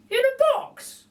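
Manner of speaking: very high, slow, chirpy lady voice; a cheeky cockney burglar lady; Burglar Betty. A narrator's reading. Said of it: "says Burglar Betty".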